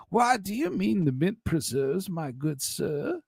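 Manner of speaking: hammed-up genteel politeness; mock genteel gentleman. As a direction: play a fussy gentleman asking politely. Continues the put-on accent bit.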